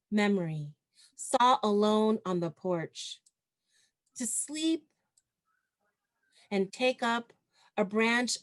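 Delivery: measured poem recital, pausing between phrases; poetry reading. Acted. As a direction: lift and project each phrase, then let it settle. Reads own poem to audience.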